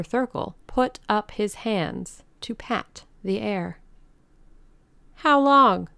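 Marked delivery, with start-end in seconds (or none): 5.2-5.9 high, loud question; anxious demand. A narrator's reading. Old ladies press the organizer for an answer, high and loud. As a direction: throw the question out sharply.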